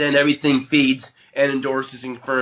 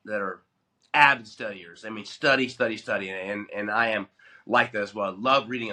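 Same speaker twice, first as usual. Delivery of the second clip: ordinary talk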